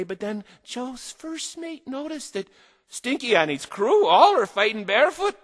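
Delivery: ordinary delivery, nothing marked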